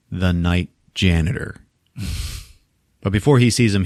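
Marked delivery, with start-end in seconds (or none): none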